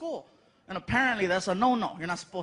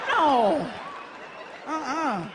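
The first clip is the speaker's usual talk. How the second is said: put-on girlfriend's no; 4 semitones higher, much slower than usual. Girlfriend refuses.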